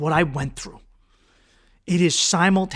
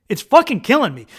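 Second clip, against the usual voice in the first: louder, higher insistence on killing; raised, emphatic outburst; agonized emphasis; 5 semitones higher, 6 dB louder than usual. Retold or imitated. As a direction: hit it harder, as if in agony. Repeats it, escalating in agony.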